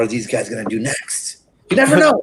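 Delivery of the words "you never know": playful tease. Acted. Teasing next season.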